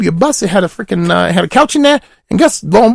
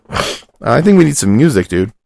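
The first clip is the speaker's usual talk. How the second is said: deep goofy character voice; low put-on voice; 8 semitones lower than usual. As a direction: drop into a low silly voice.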